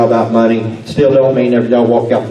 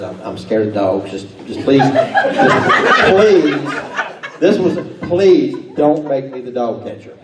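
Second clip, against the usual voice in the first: pleading; 2 semitones higher, slower than usual. Retold or imitated. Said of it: "this man, he says".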